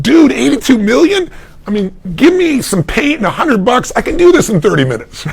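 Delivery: high, incredulous teasing; mock disbelief. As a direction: scoff at the price, playfully. Teasing friend over pricey painting.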